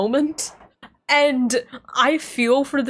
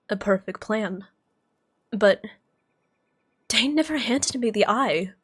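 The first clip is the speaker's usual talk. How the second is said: softer, lower read of the hero's lines; reading game dialogue; 3 semitones lower, 4 dB quieter than usual. Acted. Softer, lower dialogue read. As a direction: back in role after the laughing fit.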